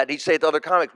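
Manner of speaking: normal talking voice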